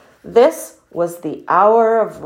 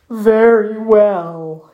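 slow, drawn-out resigned muskox; weakly; 4 dB louder, much slower than usual. A narrator's reading. Muskox giving in to his friend, weakly. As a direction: give in feebly, dragging the words. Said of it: "he said weakly".

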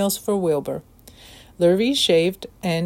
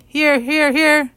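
goose answering; 8 semitones higher, 4 dB louder, slower than usual. A narrator's reading. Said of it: "said the goose".

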